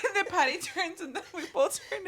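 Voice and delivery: usual speaking voice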